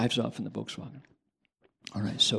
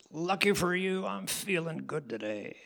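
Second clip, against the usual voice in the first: a hint of a menacing biker, higher; tough biker; 6 semitones higher, 4 dB quieter than usual. Biker threatening the driver who almost made him crash, lightly menacing.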